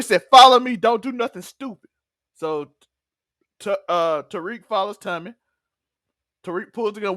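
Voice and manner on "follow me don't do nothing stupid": commanding order. Retold or imitated. Tommy ordering Tariq at gunpoint.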